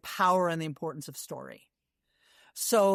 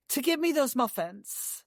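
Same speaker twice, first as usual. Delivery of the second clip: ordinary talk, nothing marked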